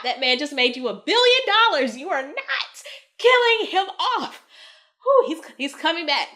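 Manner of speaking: high, loud, indignant pleading; upset fan shouting at the studio; emotional outburst. Real, not acted. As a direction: insist they can't kill him off. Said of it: "I'm getting emotional".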